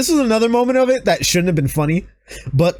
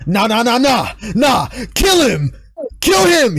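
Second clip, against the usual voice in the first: mock kill order; 4 semitones higher than usual. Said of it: "Roman Reigns gets up and he's like".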